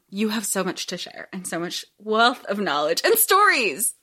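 ordinary delivery

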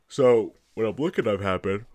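words stretched through a yawn. Real, not acted. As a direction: talk while a yawn breaks through. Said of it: "pardon my yawn".

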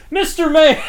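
high, loud call-out from a blank brain; mock exclamation. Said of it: "so it's like".

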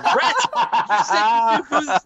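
high-pitched, laughing mock exasperation; exasperated disbelief. Parent retelling scolding Rhett over the birthday kid, high exasperated voice. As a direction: act out an incredulous parent, voice shooting up.

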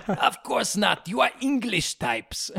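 high, taunting mock French accent; French guy. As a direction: sneer it with a hammed-up French accent. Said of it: "the French guy goes".

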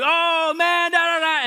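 loud, high mock reaction of onlookers; guys laughing, imitated. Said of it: "they're all laughing".

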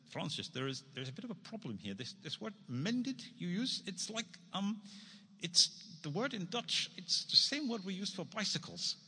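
put-on high, hammy Dutch accent; badly faked Dutch accent; terrible Dutch accent. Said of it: "I'm going to do a really terrible Dutch accent".

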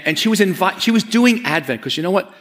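ordinary talk, nothing marked

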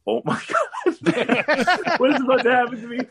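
very high, hammed-up panicky wail; retold terror, voice leaping way up; panicked dread. Acting out own dread before walking out.

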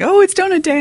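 squeaky mock delight at donuts; mock donut-day cheer. Mocking coworkers' high excited cheer. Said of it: "everyone's like".

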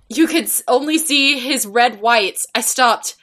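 ordinary delivery

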